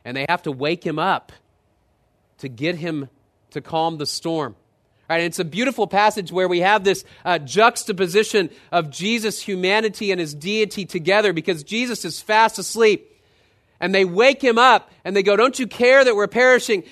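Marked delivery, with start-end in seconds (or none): 4.5-15.4 loud, high, rising sermon delivery; preaching at full force. Acted. Preacher telling the congregation about Jesus, at full force. 15.4-16.8 loud, high desperate cry; panicked disciples. Retold or imitated. Frightened disciples waking Jesus in the storm, loud and panicked.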